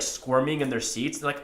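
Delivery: ordinary delivery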